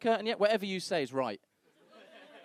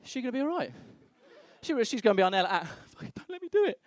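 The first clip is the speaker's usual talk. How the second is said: high-pitched mock panic; alarmed partner; 7 semitones higher, faster than usual. Worried partner looking at the huge doctor, high and panicky. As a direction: act out rising alarm, jumping up high at the end. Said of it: "I'm looking at this guy like".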